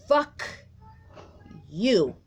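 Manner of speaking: high, loud furious spat curse; channeled angry curse. Pretend. Reader voicing the woman's rage at the man, angry shout. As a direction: spit it out as her rage. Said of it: "just wants to scream".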